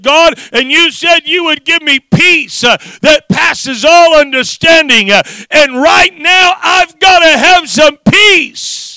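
high, straining, building shout; screaming at God. Retold or imitated. Father re-enacting desperate prayer for peace, screaming. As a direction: demand it at full preaching force, stretching the end. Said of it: "screaming at the top of my lungs".

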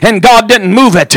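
ordinary delivery

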